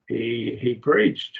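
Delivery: "he, he preached" with PREACHED pitched high; emphatic doubled pronoun. Shown as a demonstration. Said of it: "It's emphatic".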